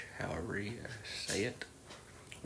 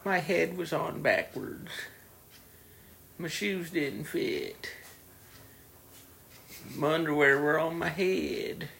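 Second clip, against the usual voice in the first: higher, slow mock whine; whiny excuse voice; 7 semitones higher, slower than usual. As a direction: drag out silly excuses, whining. Mimicking customers' excuses.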